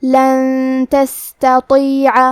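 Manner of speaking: لن تستطیع with the ن hidden into a nasal hum before ت, very slow. Shown as a demonstration. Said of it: "غنہ کے ساتھ ادا کریں گے".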